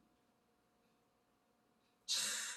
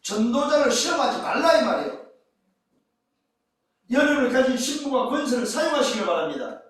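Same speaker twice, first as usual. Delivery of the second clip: raised, driving pulpit delivery; forceful preaching; 10 semitones higher, 10 dB louder than usual. Acted. Preacher to congregation on the temptation, forceful preaching.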